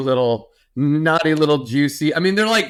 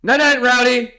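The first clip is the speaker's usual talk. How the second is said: high singsong goodnight; 8 semitones higher than usual. Real, not acted. Saying goodnight to toddler.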